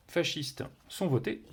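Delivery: ordinary delivery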